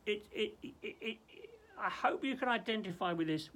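ordinary talk, nothing marked

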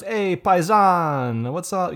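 high, slow, drawn-out "paisan", Jersey Italian guy; Italian-American greeting. Retold or imitated. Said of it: "the guy goes".

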